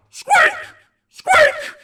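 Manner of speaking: very high squeak shouted loud; a tiny mouse squealing at full volume. Acted. Noisiest mice in the world.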